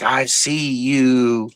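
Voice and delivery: slow, drawn-out knowing line; imitating the nun. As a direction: drag each word out like the nun who sees through it. Nun calls out the demon.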